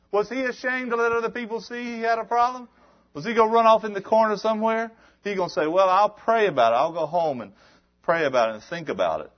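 preaching build-up. Acted. Preacher builds up, mocking delay.

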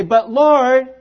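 mock whiny plea to God. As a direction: whine it like a childish complaint. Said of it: "Kind of whiny".

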